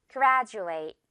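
"graduate" said GRAD-joo-ate, d turned to j; j sound in graduate. Shown as a demonstration. Teacher modelling "graduate" for the class, j sound. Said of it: "One more time".

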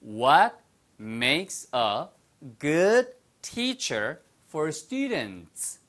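very slow, word by word with pauses; slowed-down demonstration. Shown as a demonstration. Teacher repeats the sentence for students who found it fast, slowly. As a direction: say it slowly for learners, separating each chunk. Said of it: "천천히 해드릴게요".

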